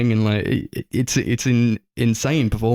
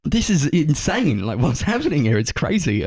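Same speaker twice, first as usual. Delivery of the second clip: retold disbelief; 6 semitones higher than usual. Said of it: "I was like".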